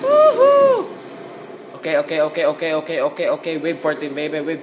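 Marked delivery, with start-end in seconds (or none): 0.0-0.9 very high, loud "woohoo"; excited whoop. Real, not acted. Gamer cheering on the zombie round, excited whoop.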